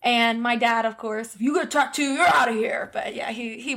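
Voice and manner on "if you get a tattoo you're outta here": raised-voice mock fatherly warning; stern dad. Dad warning kids about tattoos, mock stern shout. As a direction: call it out like a retold dad's ultimatum. Imitates strict Dad's warning.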